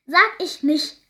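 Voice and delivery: loud, high, drawn-out refusal; slow, emphatic defiance from a captive girl; defiant growl back. A narrator's reading. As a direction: snarl back stubbornly at full volume. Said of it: "knurrte Molly zurück".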